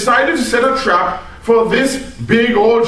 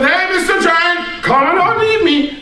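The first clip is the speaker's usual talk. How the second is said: higher, teasing call to the giant; taunting the giant; 8 semitones higher than usual. Acted. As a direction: bait him playfully, voice up. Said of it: "And they said".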